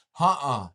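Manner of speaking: "Huh-uh" as a loud falling grunt; Seneca no. Shown as a demonstration. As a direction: snap out "Huh-uh" loudly. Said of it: "someone say".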